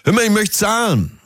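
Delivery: high-pitched call to the waiter; mock customer's high call. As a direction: call across for the bill, pitched well up. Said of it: "du rufst zum Beispiel".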